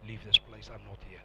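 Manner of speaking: low, soft divine voice; quiet voice of God. Retold or imitated. God telling him to leave the cult, low and soft. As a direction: speak it softly, low and still.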